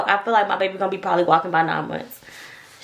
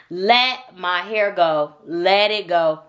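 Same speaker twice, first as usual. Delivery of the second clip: ordinary talk, nothing marked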